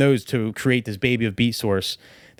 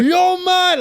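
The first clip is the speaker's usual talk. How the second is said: high, loud put-on Scottish voice that slips Jamaican; botched Scottish accent, pitched way up; bad Scottish impression; 6 dB louder than usual. Imitating the Scottish friend's voice messages in a mock Scottish accent. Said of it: "Like, I can't even do it".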